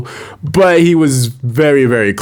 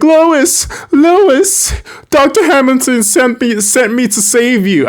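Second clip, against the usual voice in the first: high, urgent mock-heroic call, much higher; Superman voice; 14 semitones higher, slower than usual. Superman bursting in to rescue Lois, high mock-heroic call. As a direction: cry out her name like a rescuing hero. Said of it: "He's like".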